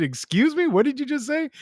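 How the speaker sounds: high, incredulous "What did you just say?"; mock outrage. Retelling his shock at the word Washingtonians, high. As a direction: play up the indignant disbelief.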